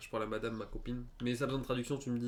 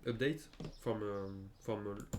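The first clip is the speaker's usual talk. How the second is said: normal talking voice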